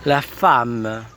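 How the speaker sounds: ordinary talk, nothing marked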